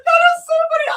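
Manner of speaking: very high, loud laughing shout; cracking up helplessly; real laughter. Real, not acted. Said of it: "I shouldn't be laughing".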